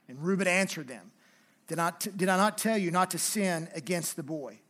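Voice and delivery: earnest Bible reading, figures lightly voiced; scripture reading. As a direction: read the passage to the congregation.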